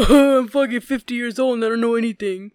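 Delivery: mock old-man ramble with loud bursts; a loosely voiced imitation of a friend; mocking impression of Bear. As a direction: lampoon your friend as clueless. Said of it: "I'm gonna do you".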